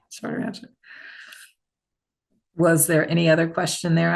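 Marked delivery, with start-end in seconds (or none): 0.1-0.7 soft playful whisper. Shown as a demonstration. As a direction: whisper it to yourself, amused. Whispers own phrase as a joke.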